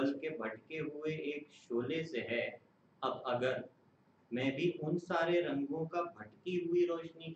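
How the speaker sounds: measured, solemn poem reading; stately nazm recitation. Acted.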